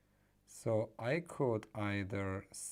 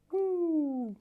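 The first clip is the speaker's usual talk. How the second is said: high, loud whoop of joy; excited whoop; 9 dB louder than usual. Real, not acted. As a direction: let out a happy cheer. Cheering at finished soldering.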